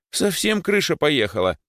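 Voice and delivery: high-pitched appalled outburst; horrified. Acted. Boy thinks rower has gone mad, horrified. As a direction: exclaim in disbelief. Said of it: "ужаснулся Димыч".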